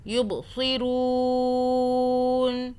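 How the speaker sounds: یبصرون chanted very slowly, ب bounced with a jolt, رو held long; qalqala recitation. Shown as a demonstration. Teacher recites with qalqala for students. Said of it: "آخر میں stop والا".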